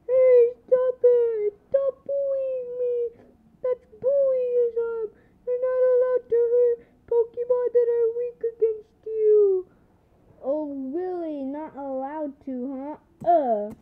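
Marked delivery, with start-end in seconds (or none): 0.1-9.7 loud, high, teary wail; whiny protest. Pretend. Squirtle toy wails at Charmander about bullying. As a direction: cry out unfairly wronged.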